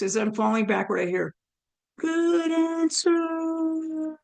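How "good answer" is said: high, held-out notes, stretched very slowly; drawn-out call. Retold or imitated. Retelling a voice heard while falling backward, high drawn-out call. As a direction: imitate a distant voice, each word stretched high.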